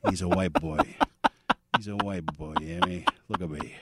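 low, soft tough-guy De Niro; quiet intimidation, staring him down; De Niro impression. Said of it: "Mister De Niro?".

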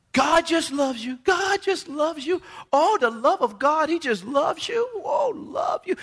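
high, drawn-out gushy mock preaching; mocking gushing praise. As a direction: croon it high and slow, mocking sugary devotion. Mimicking those who preach only love.

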